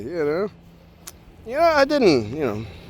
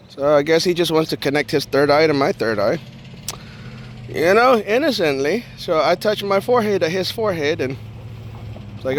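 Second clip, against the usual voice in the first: usual speaking voice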